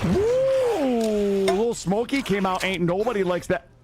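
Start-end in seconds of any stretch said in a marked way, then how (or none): 0.0-1.5 long high "woo"; excited whoop. Real, not acted. Streamer reacting to the game, excited whoop.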